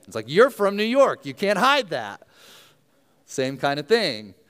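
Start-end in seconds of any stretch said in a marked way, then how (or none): 0.3-2.3 loud, high-pitched gotcha; mock accusation. Catching someone from New York by accent, mock accusation. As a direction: pounce on the giveaway loudly.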